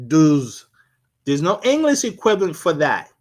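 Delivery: usual speaking voice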